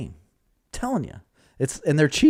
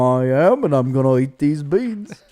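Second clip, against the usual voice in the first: mock Cockney; 3 semitones higher, 5 dB louder than usual. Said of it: "she's watching us do our impressions".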